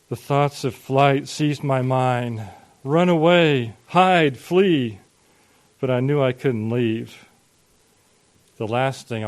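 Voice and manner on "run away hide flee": louder, higher bursts of urgency; urgent panic. A narrator's reading. Boy in his room wants to escape, urgent. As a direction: urge flight in short, raised cries. Narrator's panicked thoughts of flight.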